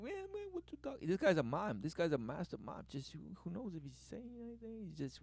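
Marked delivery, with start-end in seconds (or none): 0.0-1.1 high, soft, fast squeak; mimed voice. Retold or imitated. Imitating the mime guy, high and fast. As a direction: squeak it high and quick like a cartoon. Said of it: "He's like".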